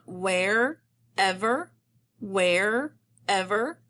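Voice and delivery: WHEREVER split slowly into "where" and "ever"; syllable by syllable. Shown as a demonstration. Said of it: "use your routine".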